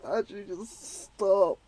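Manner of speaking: breathy, whimpering please stop; pleading whisper. Retold or imitated. Recalling his own reaction to the gory scene, whimpering plea. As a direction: beg it under your breath, sick. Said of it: "I'm just like".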